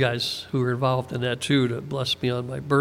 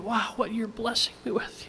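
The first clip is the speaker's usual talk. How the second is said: higher and much softer, wonder-struck; hushed awe; 6 semitones higher, 8 dB quieter than usual. Real, not acted. Preacher imagining David overwhelmed, hushed and awed. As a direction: let the wonder catch your breath.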